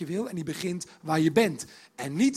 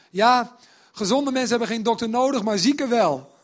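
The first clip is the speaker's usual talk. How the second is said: louder, offhand, tossed off with "Ja"; blunt casual retort; 5 semitones higher, 9 dB louder than usual. Shown as a demonstration. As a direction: say it bluntly to the scoffers' faces. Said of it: "Moet je je voorstellen dat je Matthäus bent". (luid en nonchalant, botweg gezegd)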